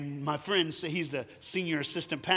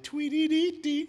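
much higher, sing-song chirp like a bird; birdsong; 12 semitones higher, 5 dB louder, slower than usual. Retold or imitated. Telling how the song's birds harmonized, high chirpy tweet. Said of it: "they harmonized and went".

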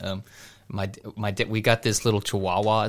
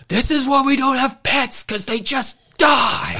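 mock yell; slower than usual. Dad burying the dog, yelling in mock exasperation. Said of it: "he yells".

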